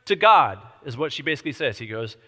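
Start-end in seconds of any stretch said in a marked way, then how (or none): 0.0-0.6 louder, higher swear to God; insistent demand. Retold or imitated. Widow pressing the king for an oath, insistent. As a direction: press him hard. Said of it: "She goes".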